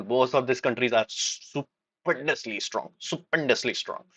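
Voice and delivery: slow, deliberate, weighty; drawn out for emphasis. Real, not acted. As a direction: spell it out slowly.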